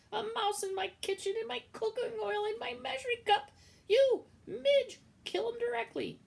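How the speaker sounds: shrill-free, very high and loud outrage; outraged cook. Acted. Cook raging at the mouse and ordering Midge, high loud outrage.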